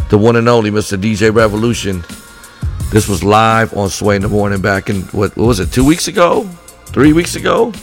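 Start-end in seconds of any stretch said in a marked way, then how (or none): none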